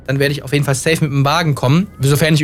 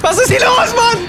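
high panicked shriek; real panic, voice shooting way up; slower than usual. Real, not acted. Plane suddenly dropping, panic.

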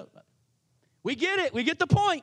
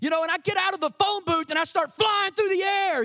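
superhero boast; 6 semitones higher than usual. Retold or imitated. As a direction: announce it like a caped hero taking off. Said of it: "it would be like me coming here and saying".

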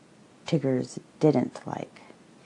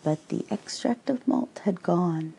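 ordinary delivery, nothing marked

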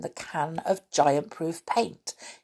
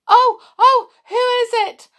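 very high, loud startled cries; frightened cry; 10 dB louder than usual. A narrator's reading. Connie almost falling off the ladder, crying out. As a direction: cry out in alarm, high-voiced girl. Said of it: "cried Connie".

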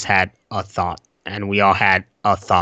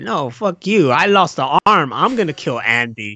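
higher, snappy mock Chucky arguing; angry Chucky voice; 6 semitones higher, 3 dB louder than usual. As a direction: play a pissed-off Chucky doll shouting down another. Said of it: "It's like".

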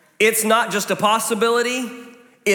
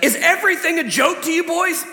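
pitched-up, half-mock scolding dad; exasperated dad; 10 semitones higher than usual. Dad scolds laughing sons over grease fire, higher mock exasperation. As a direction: play the fed-up father catching the boys laughing. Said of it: "So he's like".

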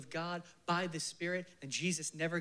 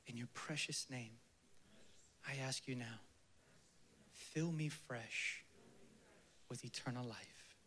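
2 soft, intimate prayer slipping into whispers; 3 pray low and tender, drifting to a breath; 1 hushed prayer; 5 semitones lower, 7 dB quieter than usual. Acted. 4 pastor leading the congregation in closing prayer, hushed and tender.

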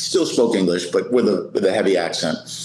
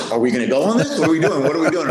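retold impatient trainer, pitch climbing; rising, incredulous prodding; urgent questioning; 6 semitones higher, much faster than usual. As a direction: imitate a trainer pressing for an answer. Trainer urgently questions the frozen rookie.

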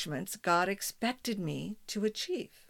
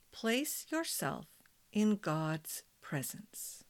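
guided meditation; 5 dB quieter than usual. Acted. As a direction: guide them gently into calm.

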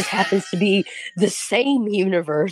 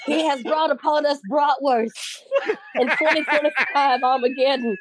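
Southern Belle; 6 semitones higher than usual. Pretend. Southern Belle bride-to-be pleads to her father, high and grand. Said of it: "delicious Southern Belle inflection".

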